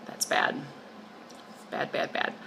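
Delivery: soft, breathy tsk-tsk to herself; whispered self-scolding. Real, not acted. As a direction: whisper it like a guilty confession. Scolding herself for neglected project.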